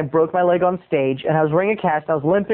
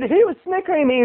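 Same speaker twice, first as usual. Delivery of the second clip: high, whiny tattling; mock accusation; 11 semitones higher, faster than usual. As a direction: accuse him in a high complaining voice. Star's acted accusation.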